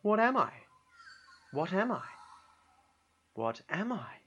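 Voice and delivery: a hint of a puzzled creature, trailing off; bunyip voice. Creature asks repeatedly.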